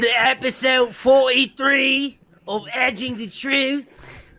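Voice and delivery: slow, high, loud mock British show intro; bad British accent, slow and pitched well up; terrible British accent. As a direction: ham up a London presenter accent. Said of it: "Please excuse my, terrible accent there".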